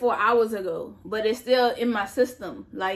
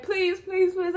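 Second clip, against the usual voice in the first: high, pleading please repeated; desperate begging; 9 semitones higher, slower than usual. Retold or imitated. Arrested woman begging the cop to let her go, high and tearful. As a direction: beg him with a rising, tearful plea. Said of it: "I was just begging him".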